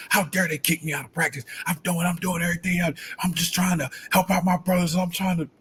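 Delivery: high, fast, indignant rant; fuming. Retold or imitated. Player retelling how angry he was at being kicked out, fast and indignant. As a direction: replay the outrage of being thrown out. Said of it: "I was still fuming".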